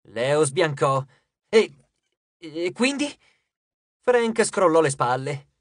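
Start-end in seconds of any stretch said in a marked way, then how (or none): 1.5-3.1 thrown, stammering. A narrator's reading. Leo pales, then stammers.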